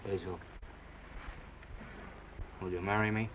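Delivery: low, soft and sincere; tender proposal. Acted. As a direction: propose gently.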